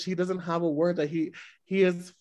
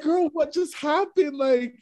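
incredulous; 9 semitones higher than usual. Retold or imitated. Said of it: "It's like".

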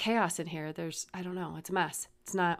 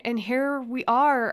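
ordinary talk, nothing marked

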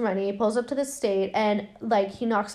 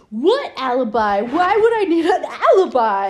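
louder and higher, hammed-up indignant man; hammed-up innocent protest; mock Jamie; 4 semitones higher, 7 dB louder than usual. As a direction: play the outraged suspect with a put-on voice. Jamie voiced in movie recap.

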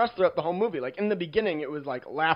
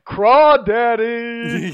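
drawn-out, high, loud dad call; imitating the dad; 7 semitones higher, 11 dB louder, much slower than usual. Dad calling out his silly catchphrase, loud and stretched. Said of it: "And he's like".

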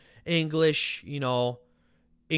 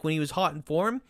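ordinary delivery

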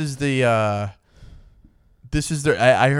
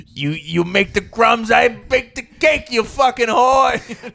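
slow, higher, hammed-up Jersey tough guy; mock Jersey husband; 8 semitones higher, slower than usual. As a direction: lord it over her, dragging each word. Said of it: "it is kind of fucked up to be like".

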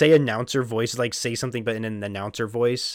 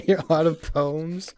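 slow, high giggly repeat; laughing; 4 semitones higher, much slower than usual. Real, not acted. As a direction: say it through laughter. Laughing at the joke.

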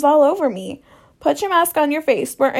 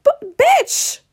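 high, stretched, sassy "bitch" with a rise; 13 semitones higher than usual. Retold or imitated. Telling the radiation nurses about the man, high sassy drawl. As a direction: drag it out with attitude, pitched well up. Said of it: "I was like".

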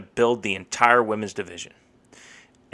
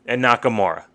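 ordinary delivery

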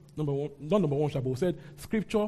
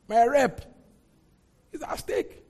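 anxious Jonah; 8 semitones higher, 4 dB louder, slower than usual. Acted. Jonah fretting over his reputation.

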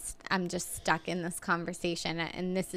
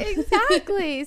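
drawn out, high and louder; emphatic; 12 semitones higher, 6 dB louder, much slower than usual. Real, not acted. Agreeing with friend, emphatic and drawn out.

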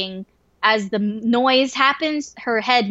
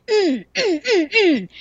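dog grunts; 8 semitones higher, slower than usual. Retold or imitated. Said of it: "She's like".

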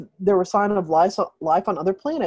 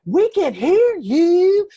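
high, slow, louder singsong call; 12 semitones higher, 5 dB louder, much slower than usual. Retold or imitated. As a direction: sing it out to strangers in the dark. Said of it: "So Glitter was like".

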